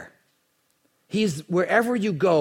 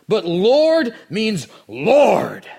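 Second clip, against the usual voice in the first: very slow, louder and higher, every word hammered; emphatic point; 7 semitones higher, 8 dB louder, much slower than usual. Real, not acted. Pastor insisting to the congregation, slow emphatic declaration. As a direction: pound the pulpit with it. Preacher driving home his point.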